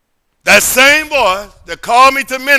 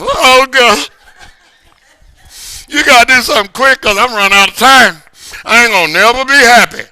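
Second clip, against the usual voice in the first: louder, pleading cry to God, half-performed; imitating his own tearful prayer, raised voice; retold crying prayer; 4 dB louder than usual. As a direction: replay a lonely man's desperate plea. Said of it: "I'm crying".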